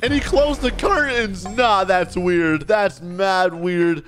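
high outburst, then slow drawn-out protest; alarmed disbelief. Real, not acted. Player seeing the curtains closed, alarmed disbelief. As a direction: recoil aloud, stretching the words.

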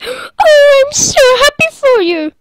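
very high put-on mum voice; gushing mum impression. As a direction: coo like an overjoyed mum, hammed-up. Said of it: "She'll just be like".

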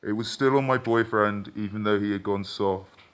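ordinary delivery, nothing marked

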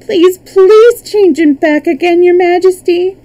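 high, loud desperate plea; imploring girl pleading with a king; begging. A narrator's reading. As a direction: beg urgently, voice pitched right up. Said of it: "begged Dorothy".

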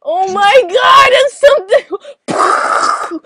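high, loud, drawn-out squeal; overjoyed cry; excited shriek. Real, not acted. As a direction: squeal with delight. Excited outburst at song news.

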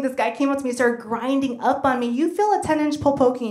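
ordinary talk, nothing marked

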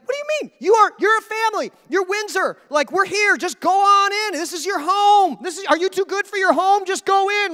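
exasperated family insisting you walk right in; incredulous urging. Acted. Incredulous royals urging newcomer inside.

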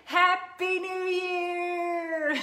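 loud, high, slow celebratory cheer; new year cheer. Pretend. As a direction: cheer it out like midnight just struck. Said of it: "Join with me".

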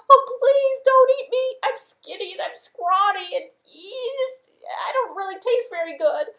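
very high, loud crying plea; begging goat. Acted. Little goat begging troll, very high crying goat voice. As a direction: whimper and plead for your life.

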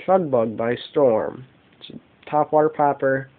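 usual speaking voice

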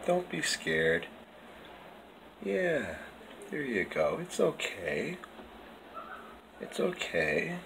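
slow, hushed reassurance; calm him gently, barely above a breath; slow soft words to a frightened cat; soft soothing. Real, not acted. Calming the scared cat.

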